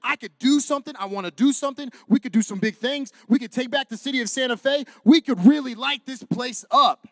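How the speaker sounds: loud, driven preacher build; preaching at full force. Acted. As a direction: preach it big to the room. Preaching intro.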